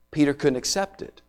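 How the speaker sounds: ordinary delivery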